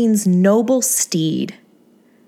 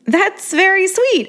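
high, slow sugary reply; sugar-coat it, high and slow; put-on sweetness; 11 semitones higher, slower than usual. A narrator's reading. Thanking Angeline for the compliment, a high sugary voice.

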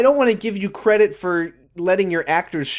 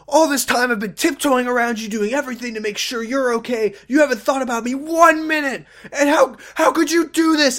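hammed-up Ben; 10 semitones higher than usual. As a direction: overplay the hurt husband. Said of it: "he's like".

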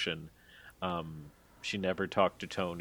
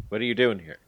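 mock hostile challenge, louder; 3 semitones higher, 7 dB louder, faster than usual. Allie challenging the newcomer backstage, in a mildly hostile tone. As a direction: fire the question off like she doesn't belong. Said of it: "probably makes it sound a little more hostile".

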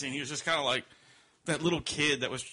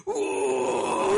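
high, loud mock stage howl; loud high crying ooh; 6 dB louder than usual. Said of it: "you on stage go".

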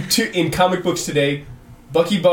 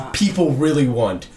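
ordinary talk

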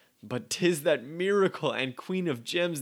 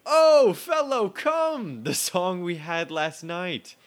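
hammy stage actor; 5 semitones higher, 6 dB louder, slower than usual. Acted. Orsino calls for the fool.

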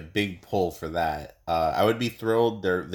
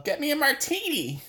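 very high-pitched character impression; Karen impression. Impersonating Karen ordering a martini, high-pitched character impression. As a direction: put on a far higher comic voice. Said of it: "That was my Karen impression".

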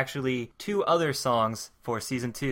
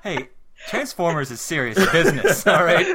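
laughing; 4 semitones higher, 7 dB louder than usual. Real, not acted. Laughing mock scolding.

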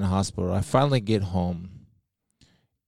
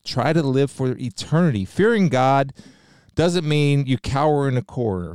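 normal talking voice

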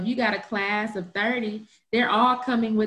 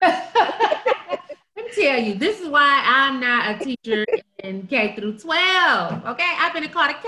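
high-pitched, laughing declaration; amused outburst; 5 semitones higher, 3 dB louder than usual. Real, not acted. Cohost explaining why she avoids school teaching, laughing outburst. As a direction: react through real laughter, voice raised. Laughing reaction to the student story.

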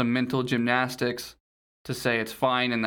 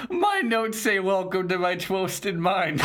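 mock dramatic; 7 semitones higher than usual. Reading edgy notes dramatically.

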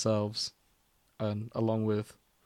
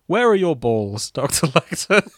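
laughing through it, a little slower; giggling reminder of the line; louder, laughing mock question; laughing quote; 5 semitones higher, 6 dB louder than usual. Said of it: "remind everyone to ask".

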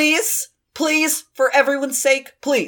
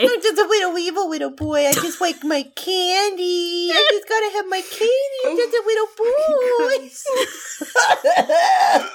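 put-on little boy voice; 9 semitones higher than usual.

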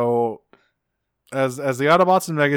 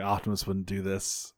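soft, low protest; quiet protest; 4 semitones lower, 11 dB quieter than usual. Retold or imitated. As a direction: say it quietly, almost to yourself. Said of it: "Jack was like".

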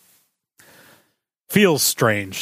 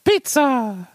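high-pitched cartoon Italian accent; bad Italian accent; 12 semitones higher than usual. Shown as a demonstration. Co-host showing off a Mario voice for pizza, bad Italian accent. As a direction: belt one word in a hammy Italian accent. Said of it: "pizza in a bad Italian accent".

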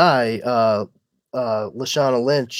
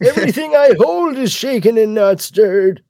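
higher, put-on old man voice; old James Bond; 9 semitones higher than usual. Old Bond on his shaking drink, in a silly high voice. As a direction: ham up an aged spy's catchphrase.

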